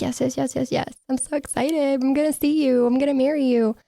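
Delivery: high-pitched, gushing imitation of an excited couple; mock excitement. Host mimics the couple getting their hopes up, mock excited. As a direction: rattle it off high like a giddy couple. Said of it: "They were so excited".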